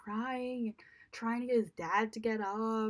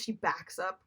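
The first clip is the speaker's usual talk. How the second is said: ordinary delivery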